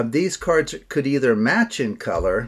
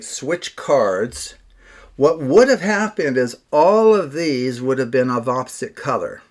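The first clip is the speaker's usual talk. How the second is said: normal talking voice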